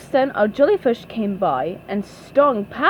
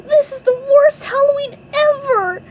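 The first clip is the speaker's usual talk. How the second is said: very high, loud tearful whine; SpongeBob crying; 7 dB louder than usual. A narrator's reading. SpongeBob despairs after failing to scare, high crying voice. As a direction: wail it like a cartoon crybaby. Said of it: "SpongeBob started crying".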